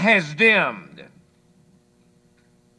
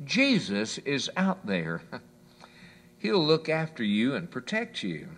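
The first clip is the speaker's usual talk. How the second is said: reassuring mother; 8 semitones lower, 8 dB quieter than usual. Acted. Mother reassures her son about the dark, soft and gentle. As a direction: soothe a scared child. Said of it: "smiled reassuringly at her son".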